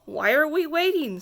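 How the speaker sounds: plain reading voice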